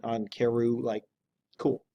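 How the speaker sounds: ordinary delivery, nothing marked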